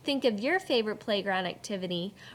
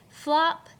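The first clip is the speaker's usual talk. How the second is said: "Flop" louder and higher; flop punched; 5 semitones higher, 5 dB louder than usual. Shown as a demonstration. Teacher punches "Flop" for the class. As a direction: smack "Flop" like it lands. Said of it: "poem sound word".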